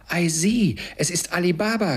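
high, loud burst of delight; delighted cry. A narrator's reading. Scrooge spots a hero from his childhood books, thrilled. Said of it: "rief Scrooge begeistert aus".

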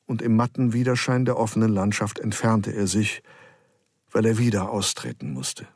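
ordinary delivery

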